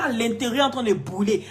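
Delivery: ordinary talk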